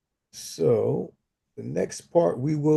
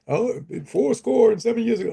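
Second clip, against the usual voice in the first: dramatic reading voice; 10 semitones higher than usual. Retold or imitated. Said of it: "I'm not necessarily gonna do the voice".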